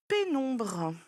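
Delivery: ordinary delivery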